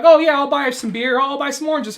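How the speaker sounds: high, loud breezy shopping voice; cheerful shopper. Retold or imitated. Soldiers buying beer and oranges, high and breezy. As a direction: call it out brightly like a happy shopper. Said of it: "and like".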